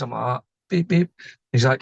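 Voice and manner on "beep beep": two short imitated horn toots; double horn beep. Driver obeying the examiner's request, two short imitated beeps. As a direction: beep twice, briefly, like a horn. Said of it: "can you pump the horn".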